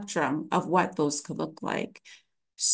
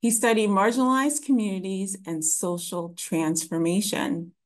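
measured, more carefully spaced repeat; slowed down. Real, not acted. Lecturer repeating for the audience after rushing, slowed down. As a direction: take your time over each word. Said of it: "Sorry, I'm speaking too quickly".

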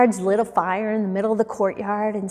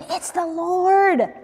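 excited realisation; 8 semitones higher, slower than usual. A narrator's reading. Disciple recognises Jesus on shore.

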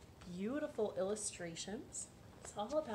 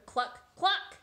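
clucking; 8 semitones higher, 7 dB louder than usual. Acted. Hen clucking.

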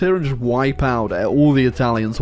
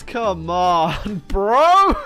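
exasperated high bro; 6 semitones higher, much slower than usual. Real, not acted. Exasperated laugh at Soviets.

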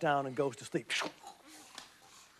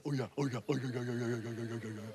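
low, softer and softer oh yeah; fading boast; 7 semitones lower, 7 dB quieter, much faster than usual. Acted. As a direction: boast then trail off.

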